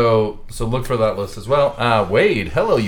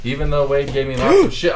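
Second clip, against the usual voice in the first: ordinary talk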